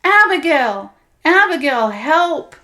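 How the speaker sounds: loud, high desperate shout; shouting for help. Acted. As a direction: call the spirit with everything left. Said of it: "She drew in a breath and shouted".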